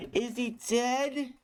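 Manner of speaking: high, slow mock-scared question; mock horror. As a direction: ham up the horror-movie fear. Mocking a horror movie trope.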